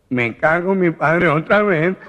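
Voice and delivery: high, loud, slow alarmed shout; the nasal man shouts at the unseen voice; startled nasal man. Acted. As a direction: yell it in a panic. Gangoso exasperated.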